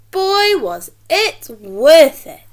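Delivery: slow, loud and emphatic; storyteller bursting with glee; excited exclamation. A narrator's reading. Narrator's exclamation.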